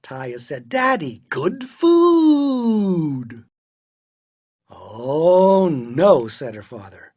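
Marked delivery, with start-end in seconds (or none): 0.7-3.4 loud, high cry with "food" stretched long; gleeful high holler; excited child shouting. A narrator's reading. Child shows off junk food. 4.7-6.3 loud, drawn-out groaning protest; exasperated parent's big objection; dismayed refusal. A narrator's reading. Father refuses again.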